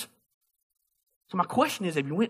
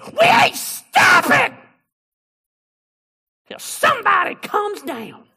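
much higher, slow, booming preacher shout; 9 dB louder, slower than usual. Retold or imitated. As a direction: bellow it like a revival preacher demanding a volunteer.

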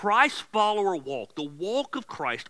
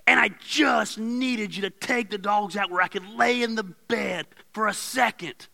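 loud, fed-up mock shout; angry wife; 5 semitones higher, 3 dB louder than usual. Sick wife scolding husband over the dogs, loud angry shout. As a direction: yell the complaint like an exhausted wife. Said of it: "Everything she's blah, blah, blah about is truth".

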